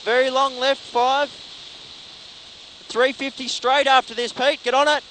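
loud, urgent co-driver calls; rally pace notes. Real, not acted. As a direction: bark out corner calls over the engine.

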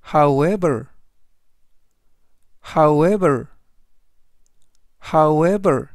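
"however" said slowly, stress on the second syllable, ending falling; slow clear English demo. Shown as a demonstration. Teacher models "however" for Korean learners, stressing the e. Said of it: "이라고 발음하시면 되겠고요".

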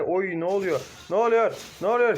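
alarmed. Real, not acted. Sudden chaos in fight.

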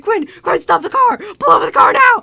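loud panicked yell; frantic shouting. Retold or imitated. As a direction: shout for the car to stop. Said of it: "he's like".

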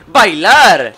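loud, high, triumphant burst; exuberant announcement. Acted. Transformed companion wanting to dance, exuberant shout. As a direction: belt it out with glee.

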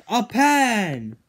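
game host unveiling the prize; big reveal. Pretend. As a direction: land the reveal with a flourish. Announcing the ultimate prize.

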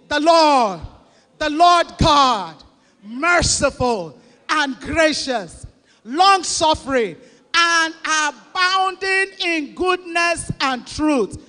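loud, slow, emphatic group reading of scripture. Real, not acted. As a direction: read it out boldly with the congregation. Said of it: "read it together in a loud voice".

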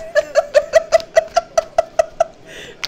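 very high, long cackle; high shrieking laugh. Real, not acted. Laughing at own joke.

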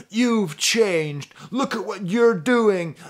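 loud, high mock lament in own accent; hammed-up singer accusing himself, stretched words; singer berating himself. Singer scolding his mirror image, mock anguished cry. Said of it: "talking to himself in the second person".

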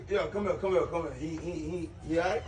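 hushed worry. Acted. Sudden hush after fight.

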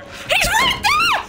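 very high, drawn-out shriek; panicked scream. Real, not acted. Player seeing the stalker right there, panicked scream. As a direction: scream it in real terror, stretching the words.

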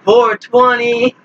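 high, slow stretched four twenty; slow meme callout. Acted. Gamer teasing the lobby with a slow high meme call. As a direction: drag out each word up high.

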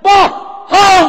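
loud, high cry of liar; shouted denial. Real, not acted. Preacher rejecting the claim with a shout. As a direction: throw the word out in outrage.

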